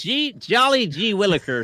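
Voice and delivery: loud high golly-gee voice; hammed-up eager boy from an old sitcom; wholesome 1950s kid. Joining the gee-whiz bit.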